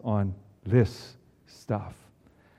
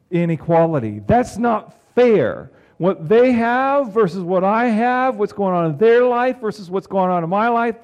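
higher, louder, slow building complaint; impassioned preaching; 6 semitones higher, 7 dB louder than usual. Acted. Preacher voicing a fretting heart to the congregation, loud and high. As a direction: preach the unfairness with rising heat.

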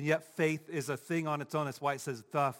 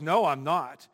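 imitated defiant retort; firm, slightly louder comeback; bold declaration; 7 dB louder, slower than usual. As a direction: declare it boldly. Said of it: "She's bold and just declares".

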